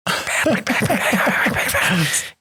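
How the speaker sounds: breathy high whisper of the cursed name; high hushed panic; frightened gasping whisper. Retold or imitated. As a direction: whisper it like the theater is haunted. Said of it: "everyone goes".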